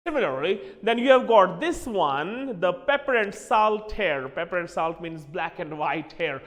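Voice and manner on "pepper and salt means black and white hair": ordinary delivery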